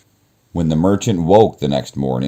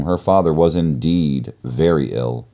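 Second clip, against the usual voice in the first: usual speaking voice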